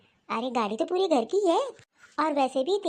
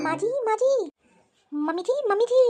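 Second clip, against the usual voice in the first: raised, higher voice calling repeatedly; 6 semitones higher than usual. Acted. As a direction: shout across the house for her. Daughter-in-law calls out to mother-in-law.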